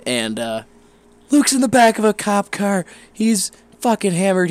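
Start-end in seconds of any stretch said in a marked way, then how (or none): 1.3-4.5 higher, louder, mock-panicked phone voice; frantic caller. A friend on the phone reports Luke's arrest, frantic. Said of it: "I get a phone call".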